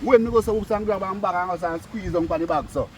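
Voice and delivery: ordinary talk, nothing marked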